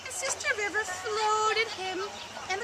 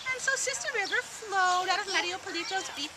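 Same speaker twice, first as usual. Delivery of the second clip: usual speaking voice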